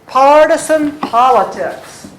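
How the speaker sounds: "Partisan politics" loud and high, beats on PAR and POL; "Partisan politics" projected as two strong dactyls; dictation for scansion. Shown as a demonstration. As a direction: call out "Partisan politics" with punched first syllables. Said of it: "I'm gonna say it".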